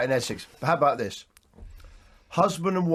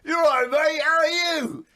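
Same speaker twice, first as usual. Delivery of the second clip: put-on high, excited call to an old mate; a Chelsea fan spotting his old mate Ian, calling out high and excited; excited greeting; 8 semitones higher, slower than usual. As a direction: hail him like a long-lost friend. Retold pub greeting, calling mate.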